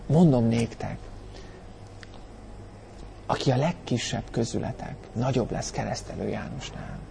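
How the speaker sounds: very soft, hushed and tender; a caring Jesus, softly; gentle, loving. Shown as a demonstration. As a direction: speak it quietly with care. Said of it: "Szeretet, törődés".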